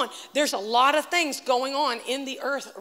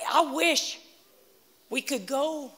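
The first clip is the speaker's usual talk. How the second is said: usual speaking voice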